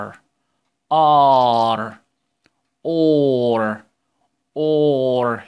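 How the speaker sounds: R said as a long, loud ar; R drawn out loud for the class to copy; R sounded out slow and loud for kids; model the ar sound. Shown as a demonstration. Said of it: "طب ننطق كده الصوت ده ايه؟".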